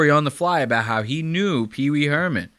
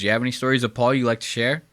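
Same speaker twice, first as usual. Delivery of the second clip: quick rattled-off question; fast; 3 semitones lower, faster than usual. Retold or imitated. Imitating the producer asking Joel quickly, rattled off fast. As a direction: rush the question in one breath. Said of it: "he said it kinda fast".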